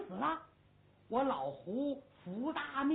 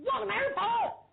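shouted question; 10 semitones higher, 5 dB louder than usual. Acted. General shouts question mid-charge.

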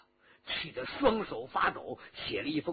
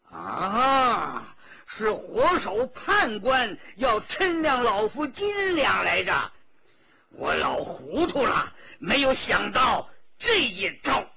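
a sudden loud cry of realization, slightly higher; loud exclamation; 6 semitones higher, 6 dB louder than usual. A narrator's reading. Old escort chief realizes the plot, loud startled cry. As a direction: blurt it out loud as the old hero sees the trap. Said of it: "大声地说".

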